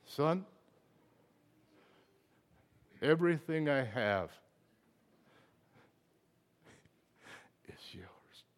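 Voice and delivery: soft, fading to a whisper; tender father. Retold or imitated. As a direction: hush it out gently like a loving father. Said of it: "What does he say?".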